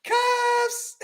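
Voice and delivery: very high sung-out cuffs; high squeal cuffs. Acted. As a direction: call it out in a high falsetto. Sound-effect call.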